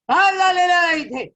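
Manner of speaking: a sage bellowing in fury; loud roar. A narrator's reading. Sage roars at the lovers, loud shout. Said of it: "त्यांनी जोरात आरोळी ठोकली".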